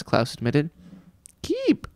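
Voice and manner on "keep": put-on very high baby squeal; high infant squeal; baby shriek. A narrator's reading. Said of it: "Sunny shrieked".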